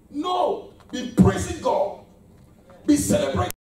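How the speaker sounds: loud rising exhortation, slow on praising; fiery sermon build; preaching at full force. Real, not acted. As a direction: preach it hard and high.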